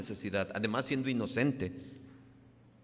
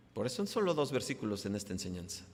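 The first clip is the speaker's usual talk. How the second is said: ordinary talk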